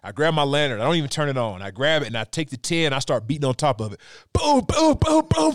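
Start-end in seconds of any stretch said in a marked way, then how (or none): 4.3-5.5 high, loud mock banging booms; banging noises. Showing how he beat the lantern to scare the moose, loud booms. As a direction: hammer out each boom.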